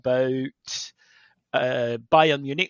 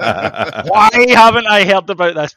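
louder, slow, mock indignant demand; mock accusing; 5 semitones higher, 12 dB louder, slower than usual. Manager turns on his PR guy, hammed-up accusing. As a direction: play it as an accusing boss. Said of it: "looked at their P R guy, accusingly".

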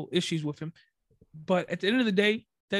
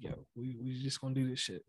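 laid-back mumble; 6 semitones lower, 12 dB quieter than usual. Retold or imitated. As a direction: toss it off quietly and casual. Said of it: "But in real life, I'm just like".

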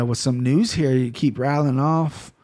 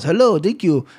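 mock German accent; 4 semitones higher than usual. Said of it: "it should come with a German guy".

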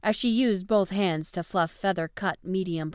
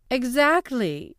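slow, higher and firm "Exactly"; deadly serious; 7 semitones higher, 4 dB louder, slower than usual. A narrator's reading. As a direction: stress it slowly, completely serious. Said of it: "still deadly serious".

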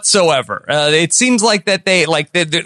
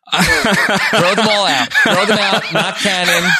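laughing; 3 semitones higher than usual. Real, not acted. Joking about scrapping sequels.

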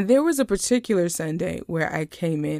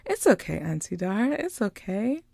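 put-on sweetly smiling child; 3 semitones higher than usual. Niece forgiving her apologetic aunt, sweet child imitation. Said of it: "she's smiling so sweetly".

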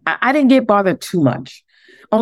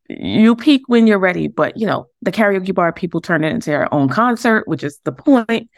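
ordinary delivery

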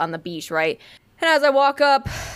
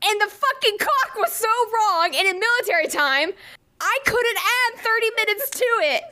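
exasperated wail; 9 semitones higher than usual. Real, not acted. Telling Zoe about failing simple math, exasperated wail. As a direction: wail it high, at wit's end. Reliving panic over the sum.

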